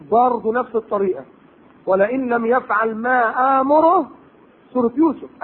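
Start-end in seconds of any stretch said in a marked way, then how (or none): 1.9-4.1 slow drawn-out verse; Quranic recitation. Acted. Preacher recites the wife of al-Aziz's threat, slowly chanted.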